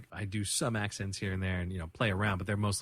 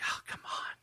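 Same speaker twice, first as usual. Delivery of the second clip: exasperated whisper; 8 dB quieter than usual. Retold or imitated. Exasperated aside at the TV scene.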